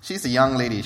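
normal talking voice

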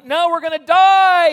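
loud, high, drawn-out lament; hammed-up cry of doom, stretched slowly; despairing wail. Acts Joshua despairing on the ground.

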